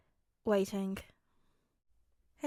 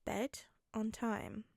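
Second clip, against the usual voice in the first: usual speaking voice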